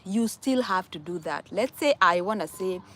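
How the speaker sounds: ordinary delivery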